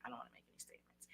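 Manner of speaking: very soft, low murmur; slow, unchanged demeanor. Shown as a demonstration. Coach answering a yelling officer, calm and hushed. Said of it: "And talk slow".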